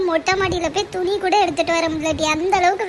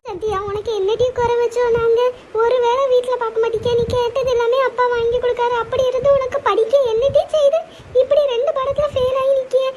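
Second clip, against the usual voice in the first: higher, louder, upset scolding breaking toward tears; tearful scolding; 5 semitones higher, 4 dB louder than usual. Acted. Mother scolds her daughter for failing two subjects, near tears.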